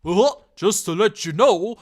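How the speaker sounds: hammed-up condescending comment voice; mock know-it-all. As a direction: read it like a smug fan correcting everyone. Reading know-it-all comment aloud.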